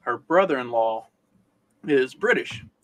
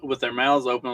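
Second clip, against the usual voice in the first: ordinary talk, nothing marked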